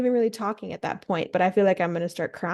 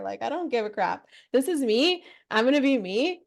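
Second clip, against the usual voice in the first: ordinary talk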